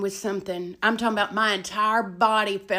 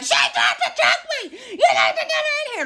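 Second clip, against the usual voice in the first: high, loud mock scream for help; shrieking complaint; 5 dB louder than usual. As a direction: imitate a cellmate screeching into the intercom. Imitates girl screaming into intercom.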